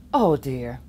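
ordinary delivery, nothing marked

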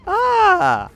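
high excited yelp. Real, not acted.

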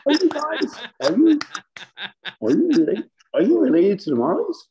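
high, loud put-on voice for Kenny; squeaky excited voice of a salesman meeting a star; imitating Kenny. Imitating Kenny at trade show.

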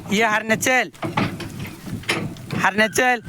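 high sing-song call to a calf. Real, not acted.

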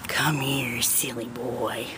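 soft breathy whisper to a dog. Real, not acted. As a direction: coax him over in a hush. Coaxing the dog over quietly.

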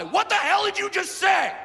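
high-pitched, fired-up challenge; acted furious roadside shouting; angry yelling. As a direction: yell like you're calling someone out to fight. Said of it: "I start yelling at this guy".

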